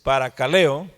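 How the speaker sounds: assured, projected teacher delivery; confident announcement. Real, not acted. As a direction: announce the word clearly to a class. Announces the checked Greek word.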